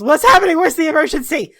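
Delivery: tinggi dan keras, baru terbangun kaget; startled alarm. Acted. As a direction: panik baru bangun tidur. Talgan bursts out half dressed.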